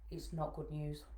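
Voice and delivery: soft, subdued admission; a little emotional. Real, not acted. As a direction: break bad news quietly, holding feeling back. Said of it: "excuse me if I get a little bit emotional".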